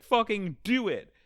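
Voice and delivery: loud, high retold yell; urging shout. Friend urging the shogi player on, urging shout. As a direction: egg her on in a mock shout. Friend urges her on in retelling.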